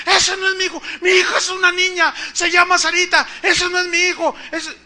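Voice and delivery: high, loud, tearful wail; tearful shouting mother. Retold or imitated. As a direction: wail in refusal, almost sobbing. Mother cries she wants daughter.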